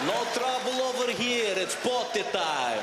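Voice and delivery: slow, hammed-up tough-guy Russian accent. Man walking to the toilet at midnight, tough Russian accent. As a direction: talk yourself brave in a thick Russian accent. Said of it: "speak to myself in a Russian accent".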